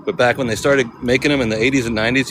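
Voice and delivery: ordinary delivery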